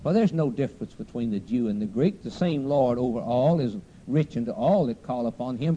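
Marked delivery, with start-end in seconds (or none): none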